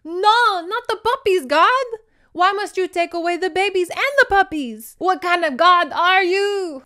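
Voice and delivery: high, loud melodramatic protest; theatrical wail building in outrage; anguished cry to God. Acted. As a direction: rail at the heavens, hammed up. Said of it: "she's now struggling like".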